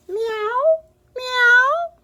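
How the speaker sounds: high, loud little kitten meow. Acted. Hidden kitten meows at Amelia, high loud mewing. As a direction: squeak it out like a tiny hidden kitten.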